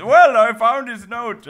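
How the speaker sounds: high, loud mocking quote; mock Putin voice. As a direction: squeak it out mockingly. Mocks Putin finding note.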